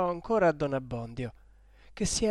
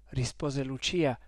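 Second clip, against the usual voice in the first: usual speaking voice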